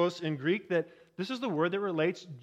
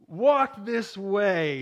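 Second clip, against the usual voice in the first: slow, louder hunched-servant Igor voice; Igor beckoning; 2 semitones higher, 5 dB louder, much slower than usual. Retold or imitated. Preacher acting Igor beckoning Frankenstein, slow comic servant voice. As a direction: drag out the words like Igor at the stairs. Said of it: "he beckons him to follow him and says".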